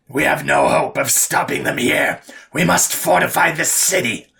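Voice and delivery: rally them with a rasping, forceful order; deep, raspy overlord. A narrator's reading. Overlord telling her warlord to fortify the city, raspy commanding call. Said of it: "her deep and raspy voice resounded".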